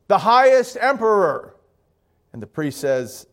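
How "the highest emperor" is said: loud, raised proclamation; grand announcement. Acted. Funeral party answering the priest at the door, grand announcement. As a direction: proclaim the title to a closed door.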